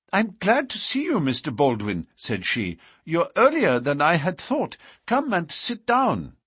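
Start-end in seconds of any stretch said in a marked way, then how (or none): 0.1-2.1 high-pitched, flustered woman's voice; alarmed young woman. A narrator's reading. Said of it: "full of confusion and alarm". 3.0-6.4 high-pitched, flustered woman's voice; alarmed young woman. A narrator's reading. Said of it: "full of confusion and alarm".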